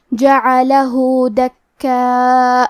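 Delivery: "جعلہ دکا" chanted very slowly with long-held vowels; lengthened madd. Shown as a demonstration. Teacher demonstrates the lengthening, very slow chanted "جعلہ دکا". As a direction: stretch the end of "جعلہ" and hold the aa of "دکا". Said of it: "دو مد کے برابر لمبی کریں".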